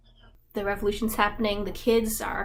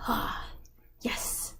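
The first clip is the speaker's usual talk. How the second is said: hushed, dramatic breathy yes; dramatic whisper; 7 dB quieter than usual. Retold or imitated. Javert greets Valjean in a hushed, dramatic whisper. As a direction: breathe it like a villain savoring the moment. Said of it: "Very dramatic".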